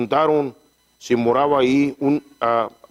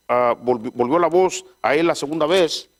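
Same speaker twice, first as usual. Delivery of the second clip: normal talking voice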